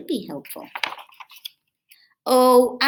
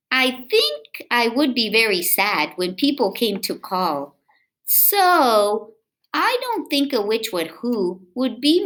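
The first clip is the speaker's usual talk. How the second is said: lively, sing-song read-aloud voice; reading to children; 7 semitones higher, 7 dB louder than usual. A narrator's reading. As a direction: read it to little kids with bounce. Reading picture book aloud.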